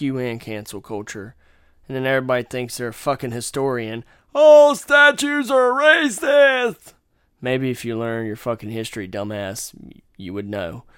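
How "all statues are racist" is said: much higher, louder, slow mocking cry; mocking self-made historians, shrill slogan; mock protester shout. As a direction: shriek it like a hysterical activist.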